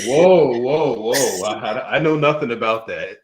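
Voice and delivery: giggling, playing innocent; laughing mock denial. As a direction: pretend innocence through a laugh. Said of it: "It's like".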